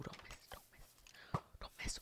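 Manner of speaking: very soft whispered warning; hushed, repeated under the breath. Real, not acted. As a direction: whisper it to yourself. Muttered repeat of catchphrase in game.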